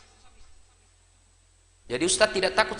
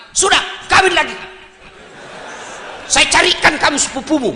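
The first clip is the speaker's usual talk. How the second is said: urging mother-in-law; 4 semitones higher, 4 dB louder than usual. Retold or imitated. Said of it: "dicuci otaknya sama mertuamu".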